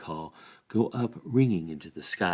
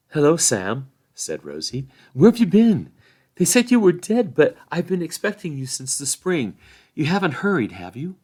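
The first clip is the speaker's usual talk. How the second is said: Rosie, higher and louder, half scolding; teasing young woman; 7 semitones higher, 6 dB louder than usual. A narrator's reading. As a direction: tease him for taking so long. Rosie scolds Sam for delay.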